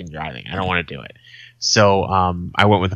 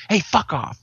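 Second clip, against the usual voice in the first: mock yell at the dog, pitched higher; 7 semitones higher than usual. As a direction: shoo the stray off with a retold shout. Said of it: "I get loud".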